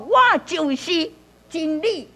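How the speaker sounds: 我就是 lifted high, then 真理 set apart; emphatic. Shown as a demonstration. Teacher demonstrating emphasis in a verse, stressed 我就是. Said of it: "你用加强".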